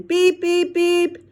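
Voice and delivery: high clipped beep beep; toy horn beeps. Acted. Monkey honking the toy horn in the garage, high beeps. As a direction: chirp it like a little toy horn.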